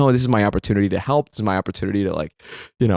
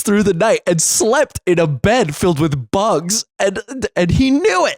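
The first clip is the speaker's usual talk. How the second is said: higher-pitched, seething indignation; retold outrage, pitched up and piling on grievances; 7 semitones higher than usual. Son inwardly fuming at dad over his sacrificed weekend, seething. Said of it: "I was seething".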